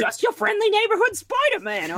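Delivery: high, loud mock Cockney voice; a silly high Cockney take on Spider-Man. Mocking a British Spider-Man.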